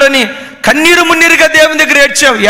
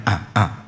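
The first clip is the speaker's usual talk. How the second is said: soft broken sobs; sobbing gasps; 11 dB quieter than usual. Acted. Samson sobs as he begs God, soft sobbing gasps. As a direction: catch the breath in sobs.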